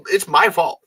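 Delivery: ordinary talk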